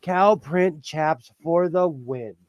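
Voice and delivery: slow, savoring each word; drawn-out cheer. Retold or imitated. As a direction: stretch it out like a victory chant. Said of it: "I was like".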